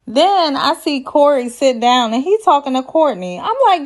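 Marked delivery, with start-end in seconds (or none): none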